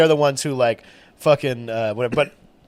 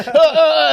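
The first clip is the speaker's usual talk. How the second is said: high, loud panicky "uh, uh, uh"; panicked stammer; 13 semitones higher, 7 dB louder than usual. Retold or imitated. Customer yelled at by counter woman, panicked stammering. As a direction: imitate a flustered customer stuck for words.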